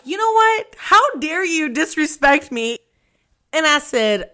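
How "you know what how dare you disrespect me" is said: high, slow, offended "how dare you"; mock-affronted, drawing it out; hammed-up outrage at the coworker; indignant outrage.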